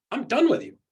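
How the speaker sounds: Go-Go's lilt. Retold or imitated. Coach imitating Go-Go's lilting way of dismissing someone. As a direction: sweetly sing the dismissal. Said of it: "She says it was a beautiful lilt".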